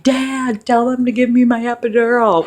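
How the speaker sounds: high, loud pleading call to Dad; woman in labor pleading for the epidural, high and loud; pleading demand. Retold or imitated. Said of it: "And I'm like".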